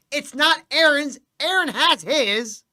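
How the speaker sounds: slow, loud, exasperated spelling-out; loud, drawn-out imitated correction; emphatic correction. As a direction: lean hard on each word, correcting him.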